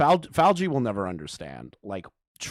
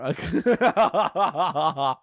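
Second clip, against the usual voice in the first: a loud burst of real laughter; laughing; 6 semitones higher, 4 dB louder than usual. Real, not acted. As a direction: crack up out loud. Laughs mid rant.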